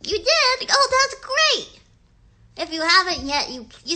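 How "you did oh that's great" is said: higher, louder and stretched out delight; thrilled kids' host praise. Acted. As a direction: gush over a child's news. Praising imagined child's craft.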